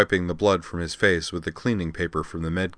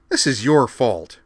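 louder, higher, slow angry retort; flaring up; 6 semitones higher, 6 dB louder, slower than usual. Acted. Liza snaps back at Amanda, flaring angrily. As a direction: flare back at her. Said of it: "Liza flared".

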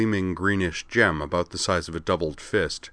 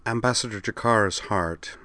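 lightly voiced, careful diplomat; diplomatic; 3 semitones higher than usual. A narrator's reading. Delenn speaking of a fellow ambassador, diplomatically. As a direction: choose the words with diplomatic tact. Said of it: "she said diplomatically".

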